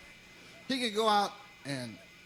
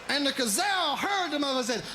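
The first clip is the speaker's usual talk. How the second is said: storyteller shout; 6 semitones higher than usual. Acted. Gazelle fable told loudly.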